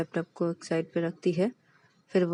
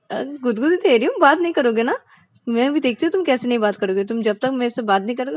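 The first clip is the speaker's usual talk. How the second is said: higher, louder teasing; playful threat; 5 semitones higher, 6 dB louder, faster than usual. Acted. Ananya tickling Payal until she talks, playfully teasing. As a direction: tease her while tickling.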